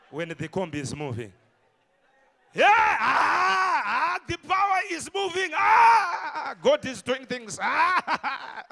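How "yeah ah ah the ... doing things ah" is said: shouting praise at full force, almost crying; loud shouted prayer. Retold or imitated. Preacher reenacting his loud prayer on the bus, shouting. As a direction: cry out to God at the top of the voice.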